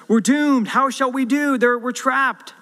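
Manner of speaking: high, loud, alarmed; panicked cry. Acted. Servant rushing to Elisha about the surrounding army, panicked and loud. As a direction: cry out that all is lost.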